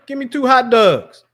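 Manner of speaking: high Baltimore order, "hot dogs" as "hot dawgs"; Baltimore accent. Retold or imitated. Host mimicking a Baltimore local ordering, high-pitched. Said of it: "and they have the accent".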